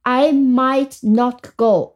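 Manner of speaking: slow, careful example sentence, every word clear; slow model reading. Shown as a demonstration. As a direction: read it out slowly for learners to copy. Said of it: "我们再看一个例句".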